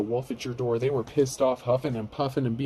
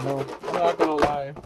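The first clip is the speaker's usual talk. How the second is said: ordinary delivery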